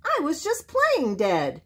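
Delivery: high-pitched small animal voice; little possum voice. A narrator's reading. Little Joe confessing to the bear, high possum voice. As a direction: pipe it up, high.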